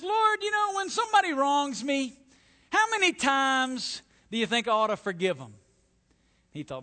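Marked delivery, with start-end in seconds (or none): 0.0-5.5 high, loud, showily pious question; eager-to-impress disciple. Acted. Simon Peter asking the Lord, showing off loudly. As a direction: put on a big spiritual show. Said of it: "gonna be really spiritual and I'm gonna impress".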